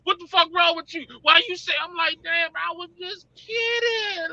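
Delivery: high-pitched, wound-up retelling of a confrontation; acted-out outburst. Retelling how people reacted to his remark, high-pitched mock outburst. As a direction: act out the squabble way up in pitch. Re-enacts an angry reaction and reply.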